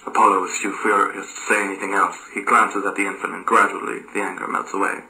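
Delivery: plain reading voice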